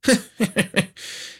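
put-on high, nasty cackle of an old aunt; wicked laugh. Aunt mocking Narcissa's plan with a cruel cackle. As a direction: laugh down at her niece. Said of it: "Walburga eut un rire mauvais".

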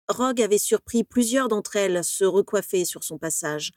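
plain reading voice

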